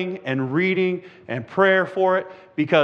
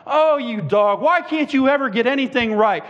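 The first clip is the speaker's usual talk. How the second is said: raised-pitch acted scolding; angry yelling owner; 6 semitones higher than usual. Angry owner scolding his dog at the door, mock yelling. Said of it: "angry man, starts yelling at him".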